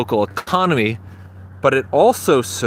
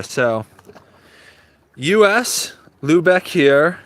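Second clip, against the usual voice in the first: usual speaking voice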